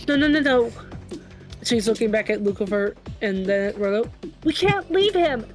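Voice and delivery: normal talking voice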